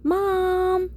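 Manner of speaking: high, drawn-out whine; complaining. A narrator's reading. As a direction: whine it like a put-upon kid. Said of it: "I complained".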